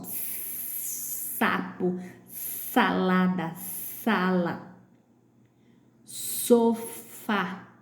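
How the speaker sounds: long hissed S opening "Sapo", "salada", "sala", "sofá"; long S sound. Shown as a demonstration. Teacher showing word-initial S, long hiss in "Sapo, salada, sala, sofá". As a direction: hold the first S, then slow syllables. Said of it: "tem o som de S".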